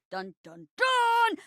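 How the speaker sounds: building "dun, dun, dun" cliffhanger sting; dramatic sting. Acted.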